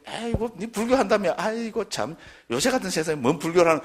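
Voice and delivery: high-pitched dismissive friend; scoffing. Acted. Friend belittling Buddhism in this day and age, scoffing. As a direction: scoff with weary disbelief.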